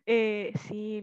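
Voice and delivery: ordinary delivery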